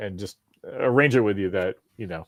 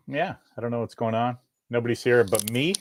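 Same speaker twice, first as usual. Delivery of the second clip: usual speaking voice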